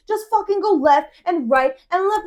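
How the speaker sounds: acted-out irate order, high and loud; angrier friend. Friend repeating directions to lost driver, angry. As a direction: hammer out each direction, fed up. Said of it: "he's getting angrier and angrier".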